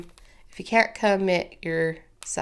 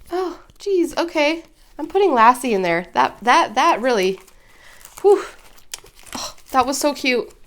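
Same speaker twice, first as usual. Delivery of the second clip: recovering from tears; 7 semitones higher, 6 dB louder than usual. Real, not acted. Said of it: "sorry for my little moment".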